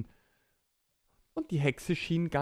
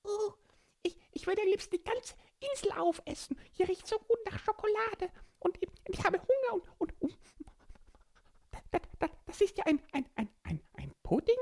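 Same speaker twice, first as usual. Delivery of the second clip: high squeaky little creature voice; small child voice; faster than usual. Acted. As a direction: speak like a tiny excited child. Borki smells chocolate.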